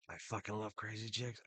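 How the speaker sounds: usual speaking voice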